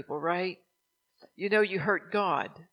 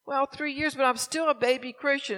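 higher-pitched imitation of a pleading friend; friend making excuses; 7 semitones higher, 4 dB louder than usual. Best friend excusing herself as a new believer, imitated plea. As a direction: plead innocence in a raised, mock-helpless voice. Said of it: "But she'd say".

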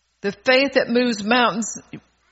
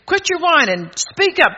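put-on sharp high scold to the kids; grandma scolding her whining grandkids, loud; 6 semitones higher, 4 dB louder than usual. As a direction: tell them off, loud and high. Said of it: "I said to my grandkids".

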